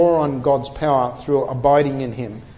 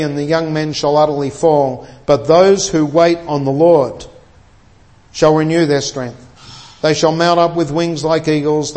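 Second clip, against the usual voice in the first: a lightly lifted pulpit reading, growing louder midway; scripture reading; 4 dB louder than usual. Acted. Preacher reads Isaiah forty to the congregation, stately and rising. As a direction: proclaim the Isaiah verses, rising in force toward eagles.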